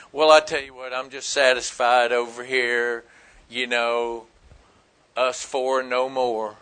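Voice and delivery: low, soft and self-satisfied; quietly content, keeping to yourself; mock complacency. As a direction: play a smug believer, voice sinking low and quiet. Acting out a self-satisfied believer.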